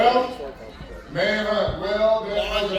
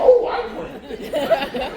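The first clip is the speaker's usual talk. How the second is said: delighted dog; 7 semitones higher, much slower than usual. Retold or imitated. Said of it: "dog happy as hell".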